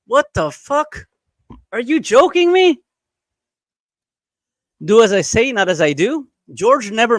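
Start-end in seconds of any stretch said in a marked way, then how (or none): 0.1-2.8 high, slow, incredulous; stunned disbelief. Real, not acted. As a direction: blurt it out in shock. Reacting to new claim by George.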